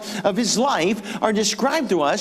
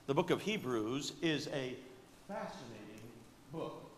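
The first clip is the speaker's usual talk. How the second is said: soft, lingering on fascinating, professorial; hushed, reverent scholar with a pause before fascinating; scholarly voice; 8 semitones lower, 19 dB quieter than usual. Retold or imitated. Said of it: "in their scholarly voice".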